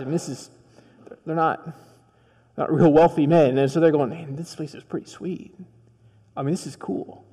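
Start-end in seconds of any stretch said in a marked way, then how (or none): 4.1-7.2 awed disciples. Acted. Disciples admire the temple.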